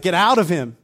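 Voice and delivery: Jesus ordering the spirit out, forceful; commanding. Retold or imitated. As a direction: cast it out. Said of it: "he's like".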